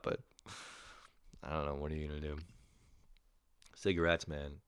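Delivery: normal talking voice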